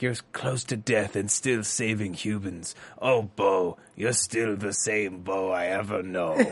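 low, drawn-in leading-man voice; Dyson. Retold or imitated. Host mimicking the TV show's Dyson for her co-host, low impression. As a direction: impersonate him in a low, softened voice. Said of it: "great Dyson impression".

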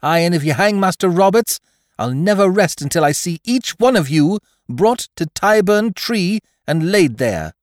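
high, loud vow of vengeance; impassioned high cry, slowing at the end; speaking out bravely. A narrator's reading. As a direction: threaten them fearlessly as a defiant lass. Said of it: "she spoke out bravely".

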